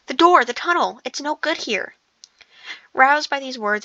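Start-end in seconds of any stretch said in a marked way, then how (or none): 0.1-2.0 higher-pitched, urgent warning; alarmed call, starting loud then easing off; urgent gasp. A narrator's reading. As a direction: urge them toward escape in alarm. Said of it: "he gasped".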